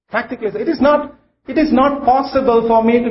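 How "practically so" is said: soft echo. Shown as a demonstration. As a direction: let the repeat drop quietly. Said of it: "And practically so".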